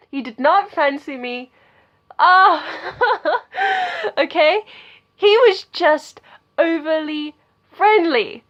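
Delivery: high, loud exasperated reveal; exasperated outburst. Real, not acted. Telling viewers he didn't fancy her, exasperated and loud. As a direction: blurt out the letdown at full volume.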